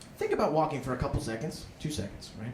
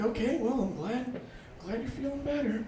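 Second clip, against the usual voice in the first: higher, softer awkward mumble; awkward reply; 7 semitones higher, 6 dB quieter than usual. Acted. [name] answering the fallen girl, higher awkward mumble. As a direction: say it meekly, not knowing where to look. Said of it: "I was like".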